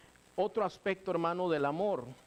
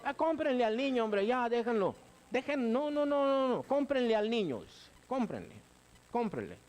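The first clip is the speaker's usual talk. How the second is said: much higher, fussy pleading voice; hammed-up softie parent begging to buy the toy; frantic mock parent caving in, fading at the end; indulgent parent; 9 semitones higher than usual. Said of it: "Qué hace el alcahuete".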